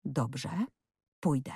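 soft, unhurried acceptance with pauses; calm. A narrator's reading. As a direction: agree quietly, giving up the fight. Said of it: "rzekła spokojnie".

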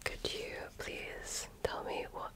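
usual speaking voice

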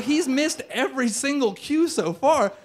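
high, slow, hammed-up indignation; exasperated complaint. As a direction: draw out the grievance, pitched high. Acted complaint about waiter to girlfriend.

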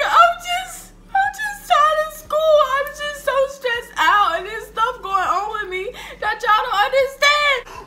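put-on teen crying to parents about school; laugh-crying plea. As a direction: wail it half crying. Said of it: "I'm like".

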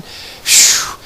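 long voiceless exhale; breathy phew. Retold or imitated. Lightheaded in the Spirit, a long exhaled phew. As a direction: blow out a whooshing breath.

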